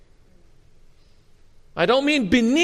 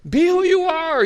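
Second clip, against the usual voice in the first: sharply higher and louder call; rousing appeal; 12 semitones higher, 5 dB louder than usual. Acted. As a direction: lift it like a royal summons. Calling Saul to nobler life.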